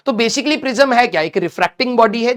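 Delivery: normal talking voice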